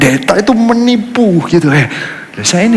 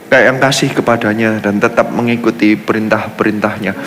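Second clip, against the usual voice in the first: lower, softer, solemn reading of a prayer; scripture reading; 11 semitones lower, 4 dB quieter than usual. Acted. Preacher reading Nehemiah's prayer to the congregation, soft and solemn. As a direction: read it hushed and reverent, like prayer.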